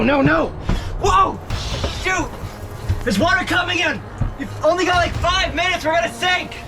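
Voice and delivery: ordinary talk, nothing marked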